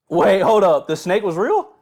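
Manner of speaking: loud high disbelief; shocked. Real, not acted. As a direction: stop everything, can't believe it. Shocked the snake was real.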